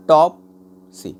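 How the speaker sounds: "टॉपसी" very slow, टॉप loud and high, pause before सी; stress on first syllable. Shown as a demonstration. Teacher telling students to notice the stress, heavy on टॉप. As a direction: hit टॉप hard, wait, then a light सी. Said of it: "टॉप पढ़ने में आपको pressure पड़ रहा है".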